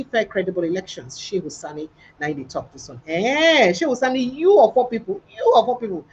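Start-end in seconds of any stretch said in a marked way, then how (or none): 3.1-6.0 higher, louder incredulous call-out, Eh and you of all people raised; mock outrage. Real, not acted. News host calls out Shehu Sani in disbelief, incredulous.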